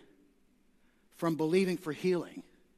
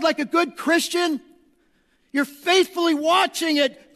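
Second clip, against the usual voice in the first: loud, high, fervent preacher's call; full-force preaching; 9 semitones higher, 9 dB louder than usual. Acted. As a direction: drive the text home from the pulpit.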